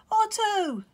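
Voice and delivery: very high, loud excited call; shouting. Acted. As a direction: call across the ice. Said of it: "he shouted up".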